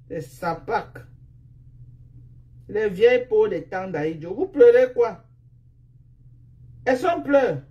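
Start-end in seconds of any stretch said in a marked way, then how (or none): 2.7-7.6 heated, rising taunts; loud scolding. Real, not acted. Streamer challenging insulting opponents who cry, loud scolding. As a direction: challenge them loudly, voice pitched up.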